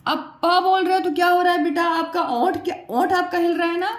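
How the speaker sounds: ordinary talk, nothing marked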